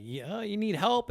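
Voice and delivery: high, drawn-out questioning call; offering help. Retold or imitated. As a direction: raise the pitch and stretch the offer out slowly. Offering help across language barrier.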